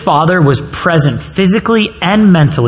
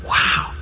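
sigh; 8 dB quieter than usual. Real, not acted. Reacting to Joseph sold.

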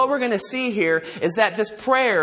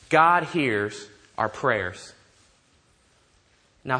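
normal talking voice

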